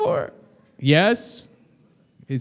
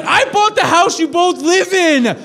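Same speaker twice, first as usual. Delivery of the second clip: exasperated rant; 10 semitones higher, 4 dB louder than usual. Pretend. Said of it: "I'm like".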